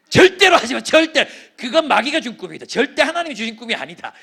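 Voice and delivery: loud, laughing outrage at the idea; shouted refusal. Retold or imitated. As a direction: bellow the ban like a stern pastor. Pastor retells forbidding the vision.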